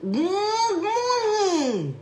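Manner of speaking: very high gurgling underwater hum. Pretend. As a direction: hum as if drowning in water. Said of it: "That's my underwater voice".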